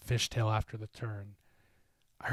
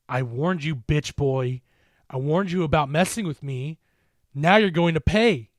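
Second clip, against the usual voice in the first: louder, taunting monster voice; menacing creature; 4 semitones higher, 8 dB louder than usual. A narrator's reading. The creature taunts Mark, menacing gloat. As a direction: gloat over the victim. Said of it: "it said, now grinning in amusement".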